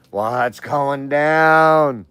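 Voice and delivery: drawn-out hollered hello; loud stretched greeting. Real, not acted. Calling out slowly to greet his buddy.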